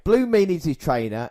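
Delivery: ordinary delivery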